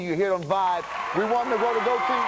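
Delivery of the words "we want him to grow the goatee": rallying shout. Real, not acted. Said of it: "rallying the audience".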